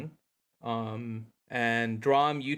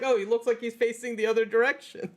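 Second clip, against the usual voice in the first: high mock voice; 8 semitones higher, faster than usual. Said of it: "I'm like".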